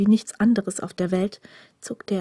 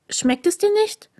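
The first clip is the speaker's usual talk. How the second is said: much higher, a bit louder, gently asking; curious young stranger; 12 semitones higher, 3 dB louder than usual. A narrator's reading. Green-eyed stranger asks the prisoner, much higher and eager. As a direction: ask the prisoner up high and caring.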